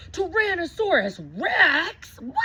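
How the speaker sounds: high, loud surprised cry; startled shout. Acted. Child spotting a dinosaur at the window, startled. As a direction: gasp it out like you can't believe it.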